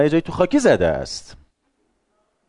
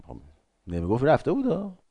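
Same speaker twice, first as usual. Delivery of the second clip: ordinary talk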